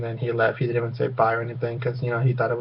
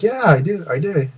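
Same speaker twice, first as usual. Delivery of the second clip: higher-pitched, lilting quoted reply; imitated keen reply; 5 semitones higher than usual. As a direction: imitate him confirming it, voice swinging up. Acting out seatmate's eager confirmation.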